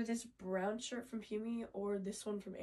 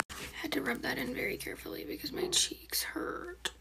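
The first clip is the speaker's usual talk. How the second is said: whispering; 3 semitones higher, 6 dB louder than usual. Real, not acted. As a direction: keep it all in a whisper. Whispers the whole video.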